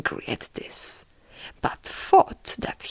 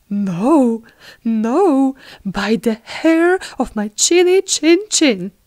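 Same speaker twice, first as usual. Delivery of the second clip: loud, higher sing-song refusal; defiant little pig; 4 semitones higher, 7 dB louder than usual. Acted. Pig refusing the wolf at the door, defiant. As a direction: refuse loudly, pitch lifting as it goes.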